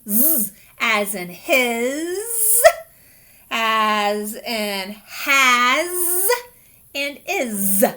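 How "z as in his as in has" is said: slow "z" stretched in "his" and "has"; z sound. Shown as a demonstration. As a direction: drag out the z buzz. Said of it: "sometimes it says z".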